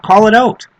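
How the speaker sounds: high, rising call to action; rally the listeners. Real, not acted. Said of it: "urging listeners to act".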